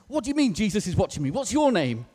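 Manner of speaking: high, fast, loud challenge; acted indignation, pitch jumping up; indignant burglar. Burglar demands answers from the parrot, indignant.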